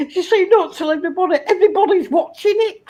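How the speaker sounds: mock plea broken by laughter; laughing. Real, not acted. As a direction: giggle it out while mimicking her. Mocking friend's plea, laughing.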